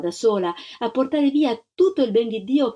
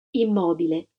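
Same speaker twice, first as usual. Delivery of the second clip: plain reading voice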